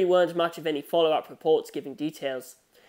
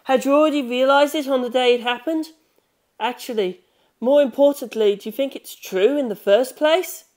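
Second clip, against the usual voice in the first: higher, louder insistent questioning; Rohan probing; 8 semitones higher, 7 dB louder than usual. Acted. Rohan questioning the boy about the death, insistent. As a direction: press him for an answer.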